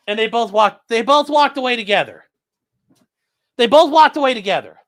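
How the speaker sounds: high, loud disbelieving complaint; mock outrage. Real, not acted. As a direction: exclaim in mock betrayal. Dogs leave, mock outrage.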